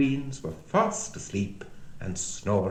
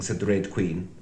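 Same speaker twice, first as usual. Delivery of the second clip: usual speaking voice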